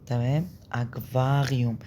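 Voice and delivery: ordinary talk, nothing marked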